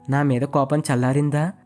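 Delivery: ordinary delivery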